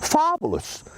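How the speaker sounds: high, thrilled exclamation; delighted. Acted. Senator delighted by Mary's story, high-pitched. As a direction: burst out with delight.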